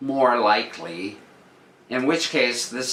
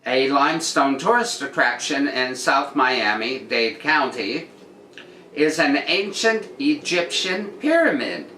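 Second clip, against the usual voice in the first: news read; 4 semitones higher than usual. Acted. Reads the article again.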